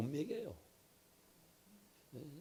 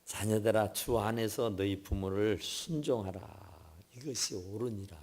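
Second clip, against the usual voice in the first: preacher intoning the verse to the congregation; scripture recitation; 4 semitones lower than usual. Acted. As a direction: read the verse with weight. Quotes scripture.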